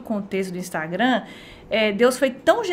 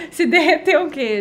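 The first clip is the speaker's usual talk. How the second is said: laughing; 5 semitones higher, 4 dB louder than usual. Real, not acted. Laugh heard on line.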